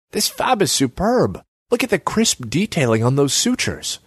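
high-pitched, impressed enthusiasm; admiring. A narrator's reading. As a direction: marvel at fine handiwork. Gangster admires the printed skull.

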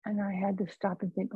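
normal talking voice

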